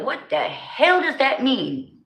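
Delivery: exaggerated bewildered question; mock confused outsider. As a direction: drag it out like a puzzled stranger.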